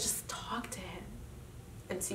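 soft breathy whisper. Real, not acted. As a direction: whisper it gently to yourself. Advice repeated, second take whispered.

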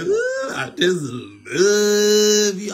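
very high squealed ooh, then slow, loud fake adoration; mocking gushing fan. Preacher parodying an adoring fan, squealing high and slow. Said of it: "I don't wanna hear nothing about".

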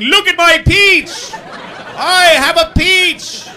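loud, high, slow manic yelling; manic shout. Retold or imitated. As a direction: wave the peach and shout like a lunatic. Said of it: "I just started going".

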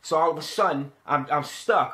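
ordinary talk, nothing marked

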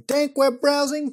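goofy title swap. Pretend. Jokey swap of show title.

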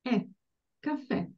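"caffè" with a very short final è; very brief vowel. Shown as a demonstration. Teacher demonstrating the accented ending, very brief è in "caffè". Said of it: "The sound is very brief".

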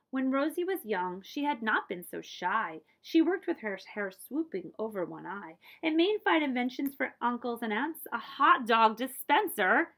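put-on rhythmic read-aloud verse for children; story narration. A narrator's reading. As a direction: read the rhyme to a child, lilting. Reading a picture book aloud.

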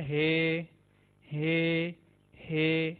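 "हे" with a breathy H; letter H. Shown as a demonstration. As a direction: say "हे" like English H. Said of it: "English के एच के जैसी". ("हे" अंग्रेज़ी एच जैसा)

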